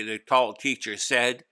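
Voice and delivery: ordinary delivery, nothing marked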